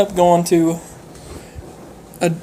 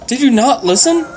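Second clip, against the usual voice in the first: higher, louder, fed-up rebuke; exasperated; 8 semitones higher, 5 dB louder than usual. Retold or imitated. The player scolds her teammate for not listening, higher and louder. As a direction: snap it at him, higher and louder. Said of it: "I was like".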